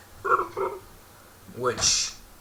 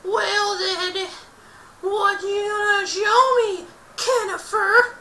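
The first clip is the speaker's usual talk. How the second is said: much higher, squeaky bully's jeer, slow; mocking high taunt; 5 dB louder, slower than usual. Justin taunts Kenneth with a squeaky high jeer. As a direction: sneer it like a schoolyard bully. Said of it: "Justin shouted out".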